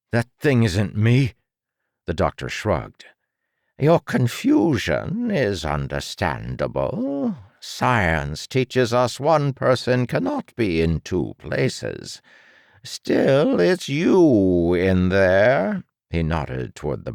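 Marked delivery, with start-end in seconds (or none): 0.1-1.3 growled. Acted. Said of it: "The boy growled". 3.8-15.8 a lightly voiced smug doctor, slow and higher; condescending mad scientist, drawn out; smug villain doctor.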